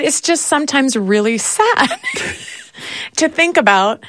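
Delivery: half laughing, half crying at the sadness; wife laughing while telling husband it's sad; laughing through tears. Retold or imitated.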